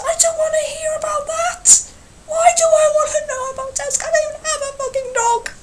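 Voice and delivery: high whispered disbelief turning indignant; incredulous rant. Acted. Ranting back at the tramp.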